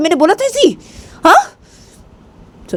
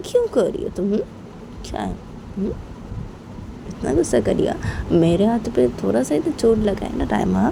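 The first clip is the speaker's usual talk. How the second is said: softly teasing; 5 semitones higher, 4 dB quieter than usual. Acted. Bani answers Raima's threat.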